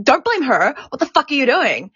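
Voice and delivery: acting out a manager's telling-off; retold angry scolding.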